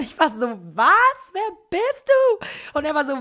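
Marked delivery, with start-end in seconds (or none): 0.7-2.5 very high, loud, stretched-out shock; hammed-up squeal of disbelief; shrieked disbelief. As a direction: act out utter astonishment. Acts own astonishment at fan.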